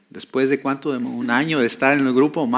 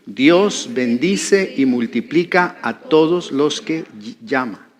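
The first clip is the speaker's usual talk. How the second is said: slow, word-by-word lead; slow group repetition; slower than usual. Shown as a demonstration. Preacher leading the congregation to repeat a phrase, slow and measured. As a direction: pace it slowly so others can repeat. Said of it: "repita esa frase conmigo".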